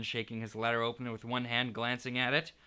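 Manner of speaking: plain reading voice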